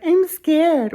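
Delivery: high, squeaky scared mom voice; mimicking mom. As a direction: play the timid mother in a thin high voice. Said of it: "she's like".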